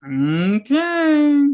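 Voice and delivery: drawn-out okay sliding way up high; loud, squeaky, hammed-up doubt; mock okay. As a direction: stretch it into a rising squeal. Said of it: "And it's like".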